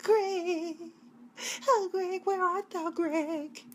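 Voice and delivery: high, drawn-out, hammed-up calls for Greg; mock-pining, high-pitched calling; dramatic mock lament. Woman tiptoeing out to find Greg, hammed-up dramatic calling. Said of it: "I'm just being really dramatic".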